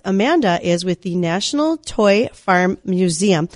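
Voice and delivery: slowly, word by deliberate word; careful slow delivery. Real, not acted. Host introducing the guest's museum without muddling it, slow and careful. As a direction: take the name slowly so it comes out in order. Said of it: "I have to say that slowly".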